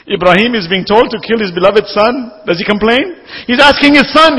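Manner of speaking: a preacher's rising cry; impassioned preaching. Acted. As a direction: drive each phrase home from the pulpit. Preaching at full force.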